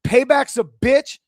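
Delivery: mock-gloating taunt. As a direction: rub it in their faces. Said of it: "when I said".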